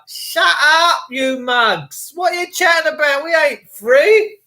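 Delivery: loud, high shout at the singers; retorting shout. Retold or imitated. Telling the singing lefties to shut up, shouting high. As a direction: yell them down. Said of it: "And I'm like".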